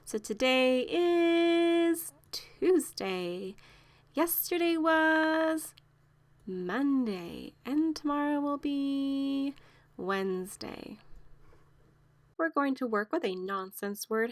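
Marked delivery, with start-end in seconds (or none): 0.0-11.0 slow, drawn-out sing-song recital; slow teacher cadence. Shown as a demonstration. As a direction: stretch each phrase for kids to follow. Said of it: "teacher models the days routine".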